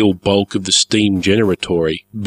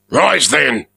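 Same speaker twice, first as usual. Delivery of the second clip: ordering; 7 semitones higher than usual. A narrator's reading. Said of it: "Melko ordered".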